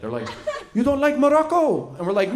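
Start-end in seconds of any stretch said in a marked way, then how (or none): 0.7-1.9 put-on high, accented offended question; hurt foreign-accented relative, pitched high; mock offended. Offended family as boys leave the couscous, mock offended. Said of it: "they were immediately offended".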